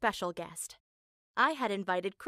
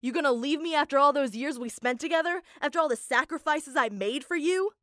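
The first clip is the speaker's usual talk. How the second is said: louder, higher angry yell; screaming; 4 semitones higher, 5 dB louder than usual. Retold or imitated. Dad screaming at mom for leaving him, loud and angry. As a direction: hurl the guilt at her at full volume. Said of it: "he started screaming".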